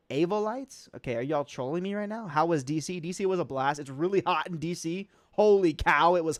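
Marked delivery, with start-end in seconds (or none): 0.1-0.9 "Avolites" as AY-vuh-lites, rising high; high questioning "Avolites", opening AY as in "day"; long-a pronunciation. Shown as a demonstration. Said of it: "four different ways that you can".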